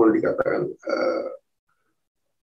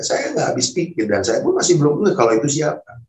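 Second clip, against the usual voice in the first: ordinary delivery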